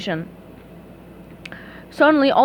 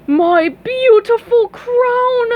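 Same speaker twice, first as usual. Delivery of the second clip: slow, much higher, louder joy; slow rapturous royal cry; overjoyed king; 11 semitones higher, 6 dB louder, much slower than usual. Acted. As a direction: gush over the crown.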